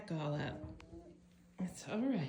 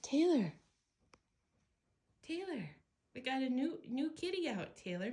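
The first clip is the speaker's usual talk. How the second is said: calling the cat; 6 semitones higher, 3 dB quieter than usual. Real, not acted.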